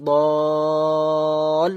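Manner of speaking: দল with a long held vowel closing on l; elongated, lam joined. Shown as a demonstration. Teacher applying the madd rule, long drawn দল. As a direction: stretch দল on one note before the lam. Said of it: "টান দেওয়ার পরে লাম যুক্ত হবে".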